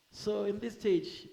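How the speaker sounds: ordinary talk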